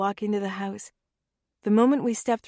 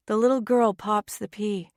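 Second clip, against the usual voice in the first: plain reading voice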